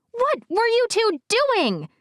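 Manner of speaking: put-on high, stern girl voice; demanding girl. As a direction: scold with a pointed question.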